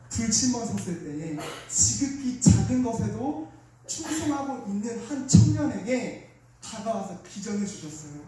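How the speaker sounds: normal talking voice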